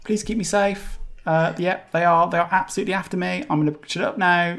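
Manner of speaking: high-pitched pleading little hamster; tiny talking hamster. Acted.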